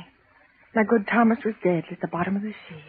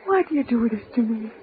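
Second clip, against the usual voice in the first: crying, whispered prayer; grieving mother whisper; tearful whisper; 5 semitones higher, 4 dB louder than usual. Acted. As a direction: plead with God through sobs. Grieving mother cries to God.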